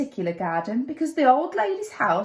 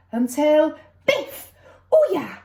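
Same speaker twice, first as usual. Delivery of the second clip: excited exclamation; 3 semitones higher, 4 dB louder than usual. A narrator's reading. Fight climax in story.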